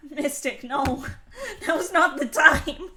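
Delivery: giggling through the words; laughing. Real, not acted. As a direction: laugh while saying it. Catches herself mid-joke, laughing.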